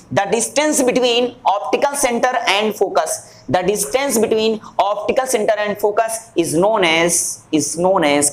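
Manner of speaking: deliberate, drawn-out definition; slow dictation. Shown as a demonstration. Teacher dictating the focal length definition, slow dictation. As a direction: dictate slowly, leaving room to write.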